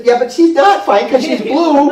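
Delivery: high, loud comic objection; mock protest. Daughter arguing with mother about the blue client, mock protest. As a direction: imitate incredulous arguing, pitch jumping up.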